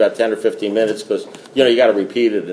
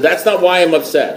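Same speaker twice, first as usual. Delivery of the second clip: higher, worked-up disbelief, falling at the end; mock exasperation; 6 semitones higher than usual. Caller challenges silent sponsor, acting exasperated. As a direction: act out growing indignation, pitched up.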